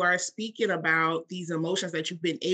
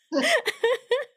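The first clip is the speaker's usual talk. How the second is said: high burst of laughter; 4 dB louder than usual. Real, not acted. Host laughing at guest's speech joke.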